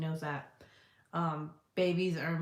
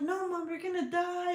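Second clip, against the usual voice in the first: put-on scared little boy; 12 semitones higher than usual. Son voiced in story.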